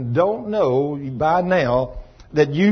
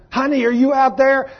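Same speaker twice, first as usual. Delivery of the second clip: higher calling voice of wife; 9 semitones higher than usual. Retold or imitated. Wife calling husband from the back door, higher voice. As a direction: call out to husband sweetly. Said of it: "she comes out ... and says".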